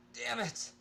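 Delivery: loud, high pained cry; sudden pained shout; groaned curse. Acted. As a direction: cry out as the light stings. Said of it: "I groaned".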